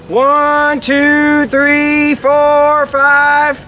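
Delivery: five steady quacks on a duck call; even quacks through the call; duck call honks. Shown as a demonstration. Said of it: "We're gonna go".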